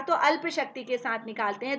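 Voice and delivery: ordinary delivery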